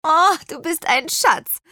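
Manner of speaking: high, gushing thanks; delighted, relieved exclamation; grateful cry. A narrator's reading. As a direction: call out your gratitude to the rescuer. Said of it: "rief Petronella".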